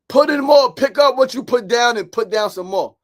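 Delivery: loud, high rallying call; rousing exhortation. Real, not acted. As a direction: push it out like a motivational speaker.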